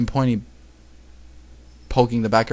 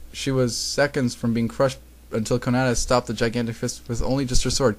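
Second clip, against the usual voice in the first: ordinary delivery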